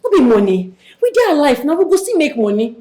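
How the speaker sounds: loud, high, forceful pleading; insistent protest. Acted. Daughter tells mother they can still make money, loudly insistent.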